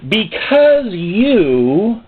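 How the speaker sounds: drawn out, weighty start; slow God voice. Retold or imitated. God starting a rebuke to the preacher, very slowly. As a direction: let it hang ominously. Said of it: "He said".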